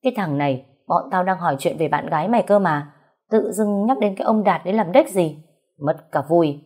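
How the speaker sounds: usual speaking voice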